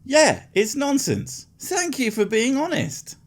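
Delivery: high, slow sarcastic agreement. Real, not acted. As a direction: drawl it, dripping sarcasm.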